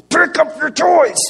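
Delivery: high, loud, drawn-out yell; mad dad yell. Retold or imitated. Dad to boys who are not listening, mad yell. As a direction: yell it, fed up and at full voice. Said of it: "I just start getting mad".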